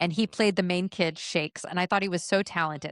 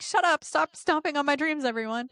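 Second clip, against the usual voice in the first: whiny protest; 8 semitones higher than usual. Retold or imitated. Teen self protesting.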